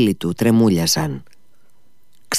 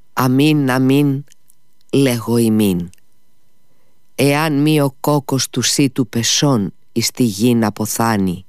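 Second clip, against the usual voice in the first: slow solemn recitation of the verse; solemn, slow, fading to a whisper; scripture reading; 3 semitones higher than usual. A narrator's reading. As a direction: intone it like holy writ. Reading the gospel verse aloud.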